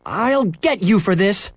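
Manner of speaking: put-on high, slow angry boy; angry threat. A narrator's reading. Boy chasing his dog, angry and slow. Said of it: "Henry was really angry now".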